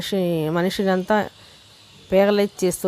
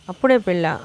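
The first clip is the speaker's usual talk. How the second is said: ordinary delivery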